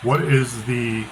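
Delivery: ordinary talk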